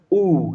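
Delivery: ऊ held long from the back of the throat; long u demo. Shown as a demonstration. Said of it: "इसका देखिए उच्चारण". (ऊ को कंठ के पीछे से लंबा खींचकर)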